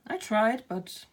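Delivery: ordinary talk, nothing marked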